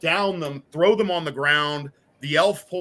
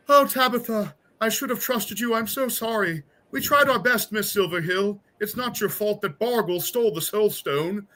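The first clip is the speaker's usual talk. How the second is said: higher, pleading voice of a dying halfling; 8 semitones higher than usual. Acted. Dying halflings apologizing to their companion, higher pleading voice. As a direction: beg forgiveness as a halfling at death's door.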